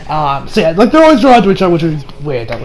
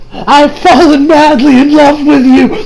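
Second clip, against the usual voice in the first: high, slow, louder love confession; dramatic confession; 5 semitones higher, 3 dB louder, slower than usual. Retold or imitated. Woman confesses to Koichi like a Shakespeare play, high and slow. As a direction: lay it on like a stage heroine. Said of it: "and then she's like".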